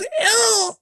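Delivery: tiny high-pitched squeal; imitated leech scream. Leech being burnt by a cigar, screaming. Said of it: "sound effect of the leech screaming".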